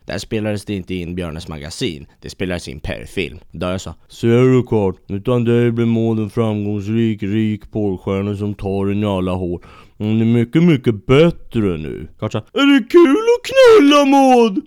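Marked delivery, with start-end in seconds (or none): 4.1-12.1 a louder, otherworldly ghost voice that slows at the end; the ghost gloating to Kurt, drawing out the last words; smug ghost. Acted. Ghost Dahi speaks to Kurt. 12.4-14.6 very high, loud squeaky voice; squeaky Kurt. Acted. Kurt asking the ghost about Maud, high and squeaky.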